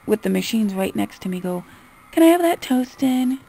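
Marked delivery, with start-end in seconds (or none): 2.1-3.4 high, loud mimicry of a customer; mocking raised-pitch request. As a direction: imitate a customer's pleading question. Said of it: "And don't go".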